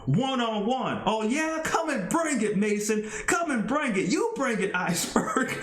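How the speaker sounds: high, taunting come and bring it; hyped trash talk. Acted. Wrestlers taunting each other before the match, hyped.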